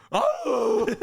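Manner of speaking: high, loud whooping howl, laughing. Retold or imitated. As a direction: let out a laughing howl into the night. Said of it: "I started to howl".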